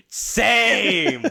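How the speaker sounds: mock excited. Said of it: "I'm like".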